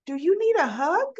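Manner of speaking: high, sweet rising question; high-pitched child imitation; imitated little boy. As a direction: offer comfort like a caring small child. Little boy offers a hug.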